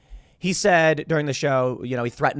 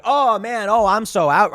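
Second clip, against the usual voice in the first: higher and louder, hammed-up alarm; mock dramatic exit; 6 semitones higher, 5 dB louder than usual. Ye bailing as the show goes live, hammed-up and louder. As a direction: play it like a diva throwing up his hands. Ye suddenly balking once live.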